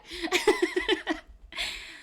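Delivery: bright burst of laughter, falling away. Real, not acted. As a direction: laugh it off at yourself. Laughs at own soda remark.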